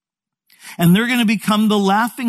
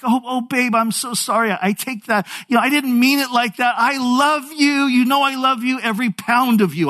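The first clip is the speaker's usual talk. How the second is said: high-pitched pleading apology; apologetic backpedaling; 7 semitones higher than usual. Retold or imitated. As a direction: imitate a husband anxiously making amends. Acted husband backpedals before upset wife.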